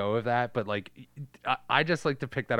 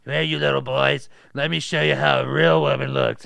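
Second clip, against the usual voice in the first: Ma Fratelli voice; 7 dB louder than usual. Retold or imitated. Miss Ramsey voiced.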